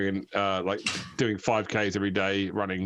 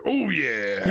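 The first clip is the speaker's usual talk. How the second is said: high wrestler catchphrase impression; Macho Man Randy Savage; 6 semitones higher than usual. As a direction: do the wrestler's drawn-out cry. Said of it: "Macho Man Randy Savage Skyrim voice pack".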